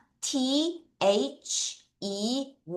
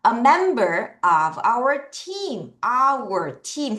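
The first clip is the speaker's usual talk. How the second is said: slow, careful read-along for the class; much slower than usual. Shown as a demonstration. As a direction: read each word slowly for students to follow. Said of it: "같이 읽어요". (천천히 또박또박 따라 읽기)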